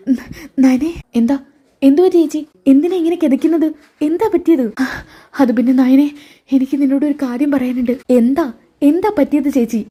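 flustered wife blurting out the danger to Nayana. Acted. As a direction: rush in frightened, stumbling on the name. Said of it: "വെപ്രാളപ്പെട്ടുകൊണ്ട്".

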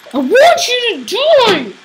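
high, swooping wail of frustration; exasperated game yell. Pretend. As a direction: wail your wish at the other players. Yelling at players in game.

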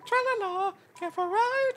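high, sing-song ferryman; a lilting greeting way up high; high boatman voice. Acted. Voicing the boat character.

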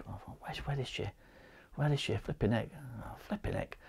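breathless near-whisper, rising panic; anxious whisper. Retold or imitated. Husband searching the house for his wife, panicked whisper. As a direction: hunt for her under your breath, frightened.

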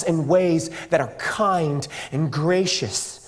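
slow, low, measured sincerity; solemn reassurance. Real, not acted. Preacher assures youth they are no accident, slow solemn delivery. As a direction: bring it down low and let it sink in.

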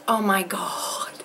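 breathless whisper, slow; awed whisper. Real, not acted. Staring at the giant mug, she whispers in awe. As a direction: hush it, stunned by the gift.

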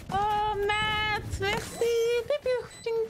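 squeaky high thank you; playful high voice. Real, not acted. Thanking teammate playfully, pew sounds.

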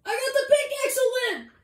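high, loud victory call; excited shout. Real, not acted. Gamer announcing the pickaxe win, excited shout. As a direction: crow it out to the squad.

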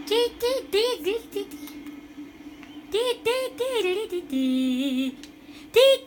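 light, high rhythmic syllables; high "dee" chant. Pretend. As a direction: sing out wordless beeps. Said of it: "I'm just yelling".

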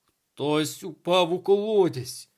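loud, high, drawn-out alarm; distraught teacher, stretched and forceful; panicking. A narrator's reading. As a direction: cry out the news in panic. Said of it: "запаникував пан Тельмайер".

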